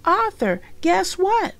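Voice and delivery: high, bright little-sister excitement; excited little sister calling her brother. Acted. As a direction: squeal it out, eager to share. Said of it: "D W said excitedly".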